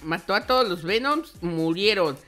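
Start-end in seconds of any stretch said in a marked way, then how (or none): none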